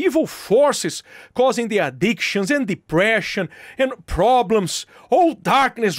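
commanding prayer against evil; fervent prayer. Real, not acted. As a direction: rebuke the spirits with force. Deliverance prayer.

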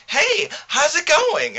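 high, slow, sugary-sweet greeting; a caller's put-on sweetness, drawn out; mock sweet caller. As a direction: play her fake-friendly hello up high. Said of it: "this bitch on my phone just told me".

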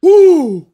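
very high, loud mock wail; hammed-up death cry. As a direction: overact a dying soldier's cry. Imitating overacted deaths.

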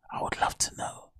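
breathy whisper, barely voiced. Shown as a demonstration. Whispering to the viewers he'd love to know. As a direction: lean in and whisper it. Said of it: "whispered repeat".

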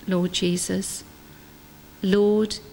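ordinary delivery

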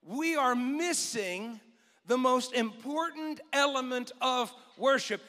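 slowed, each word laid out; slow, weighty boy's line; slow dramatic reveal. Acted. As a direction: let it land slowly. Isaac voiced, slowed for weight.

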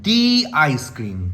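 "The" said as "dee", slow; "dee" before ice cream. Shown as a demonstration. Teacher models the ice cream with "dee". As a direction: slowly say "The" as "dee" before "আইসক্রিম". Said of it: "আইসক্রিমের আ sound আসছে".

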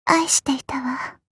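slow breathy whisper of love; whispered farewell. Acted. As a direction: breathe it out as you fade. Dying farewell line. (囁くような別れの言葉)

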